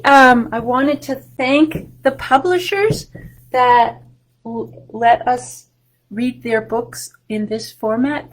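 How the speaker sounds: ordinary talk, nothing marked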